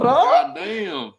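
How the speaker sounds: very high mock Southern God damn; Southern drawl. Cohost joking in a mock Southern voice. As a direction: yelp it in a hillbilly twang.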